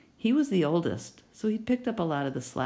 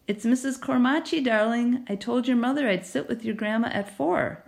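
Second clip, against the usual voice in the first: neighbor lady voice; 7 semitones higher than usual. Acted. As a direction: call through the door warmly. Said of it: "a voice called back".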